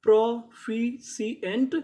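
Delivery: प्रोफिशिएंट stretched slowly, with a rising end; teacher modelling प्रोफिशिएंट slowly; slow drawn-out demo. Shown as a demonstration. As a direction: sound out प्रोफिशिएंट for the class. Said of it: "तो पूरी meaning".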